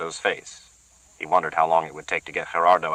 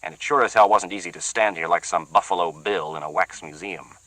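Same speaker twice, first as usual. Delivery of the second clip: usual speaking voice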